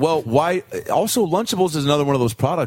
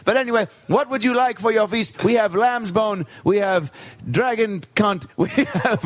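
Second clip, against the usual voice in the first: court servant; 6 semitones higher than usual. Acted. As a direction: list the royal dishes grandly. Servant lists the dishes.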